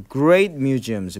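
great museums drawn out very slowly; slow model phrase. Shown as a demonstration. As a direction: stretch it for learners. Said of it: "teacher modelling". (아주 천천히 늘여 읽기)

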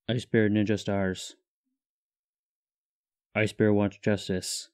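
flat, deep monotone in third person; Ice Bear deadpan. Acted. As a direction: speak like a stoic bear.

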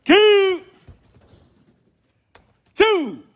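sharp high count shouts; shouted count. Real, not acted. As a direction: bark out each count loud and high. Karate teacher shouting count.

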